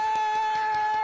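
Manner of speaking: loud high burst of laughter; delighted laugh. Real, not acted. Reacts to the big intro.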